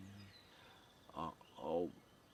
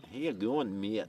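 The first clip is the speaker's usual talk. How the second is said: loud, higher Irish greeting; Irish accent; 4 semitones higher, 11 dB louder than usual. Retold or imitated. Imitating his Irish mate's greeting, loud Irish accent. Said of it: "they talk like that".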